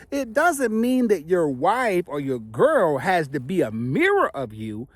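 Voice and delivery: ordinary talk, nothing marked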